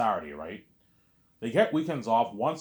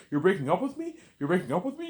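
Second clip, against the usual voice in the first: high-pitched, louder mimicked questioning; 11 semitones higher, 6 dB louder than usual. Vlogger recalling an actress's breakup line, high-pitched imitation. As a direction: mimic her in a raised, incredulous voice. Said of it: "remember she's like".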